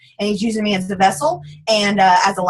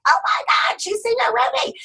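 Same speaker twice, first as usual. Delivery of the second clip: high, hushed mock squeal; starstruck freak-out; 12 semitones higher than usual. New member spotting a senior ruby, starstruck stifled squeal. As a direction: squeal it under your breath, pitch soaring. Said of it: "and, like, freaked out".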